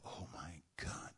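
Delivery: breathless whisper of shock; hushed, stunned gasp of recognition; awed whisper. Acted. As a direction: whisper it as the dust cloud appears. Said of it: "They go".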